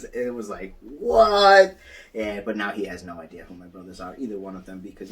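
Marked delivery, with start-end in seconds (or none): 0.8-1.8 high, loud, drawn-out what; hammed-up stunned exclamation; retold astonishment. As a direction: stretch it in mock disbelief. Retells stunned reaction.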